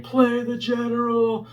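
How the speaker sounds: high, drawn-out imitation yell at no real volume; mock fan scream. Fan in the front row screaming to the singer, mock yell. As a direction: imitate a heckler's drawn-out high-pitched shout. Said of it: "is screaming".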